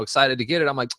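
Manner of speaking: ordinary talk, nothing marked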